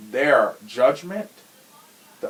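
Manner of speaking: usual speaking voice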